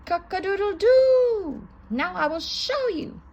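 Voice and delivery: high, loud crow sliding into a threat; loud rooster crow. A narrator's reading. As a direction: crow loudly, then bark the challenge. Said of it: "crowed loudly".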